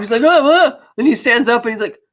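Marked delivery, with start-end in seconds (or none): 0.2-0.8 high, loud startled cries; imitated shock. Retelling Boris amid the destruction, mock shocked. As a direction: act stunned with startled little yelps.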